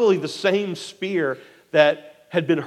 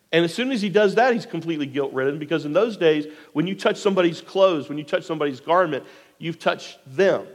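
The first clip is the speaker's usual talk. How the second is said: ordinary talk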